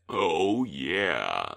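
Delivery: very deep inhaled robot voice; booming metallic robot. Pretend. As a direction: speak while breathing in, deep. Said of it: "You just inhale as you talk".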